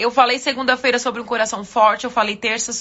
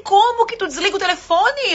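friend's indignant question; 7 semitones higher than usual. Retold or imitated. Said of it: "Ela assim".

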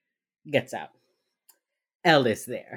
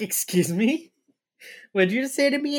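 mock indignant; 7 semitones higher, 4 dB louder than usual. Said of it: "And she's like".

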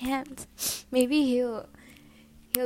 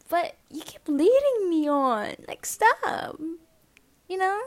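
exasperated plea; 6 semitones higher, 6 dB louder, slower than usual. Retold or imitated. Venting at him directly.

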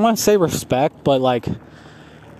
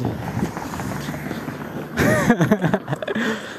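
soft, high wheezy giggle; quiet laughing fit; real laugh; 4 semitones higher, 6 dB quieter than usual. Real, not acted. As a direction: crack up helplessly at your own story. Cracks up at own story.

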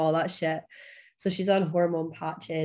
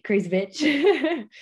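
laughing mock insult; 9 semitones higher, 6 dB louder, much slower than usual. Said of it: "and I'm like".

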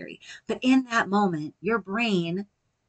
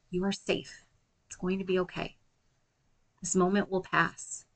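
soft, calm reassurance, slowing at the end; soothing words while rubbing your arms; gentle self-talk; 5 dB quieter than usual. Shown as a demonstration. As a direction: comfort yourself tenderly. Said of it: "speak to yourself in a loving and gentle way".

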